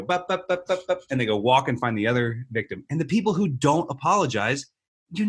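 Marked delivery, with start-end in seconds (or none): 0.0-1.0 mock marching noise.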